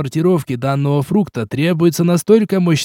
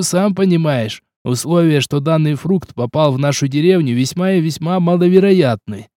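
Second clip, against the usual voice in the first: usual speaking voice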